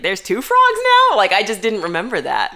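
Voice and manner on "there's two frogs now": high, shocked question; surprised. Retold or imitated. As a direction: sound baffled and surprised. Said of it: "I was like".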